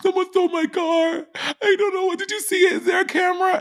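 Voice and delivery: high panicked falsetto; frantic falsetto asking staff for help. Retold or imitated. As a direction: squeal it in a hammed-up panic. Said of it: "She's like".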